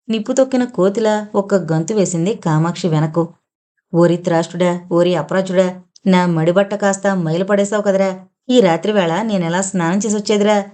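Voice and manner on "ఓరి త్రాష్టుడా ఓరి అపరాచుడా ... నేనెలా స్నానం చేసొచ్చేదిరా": plain reading voice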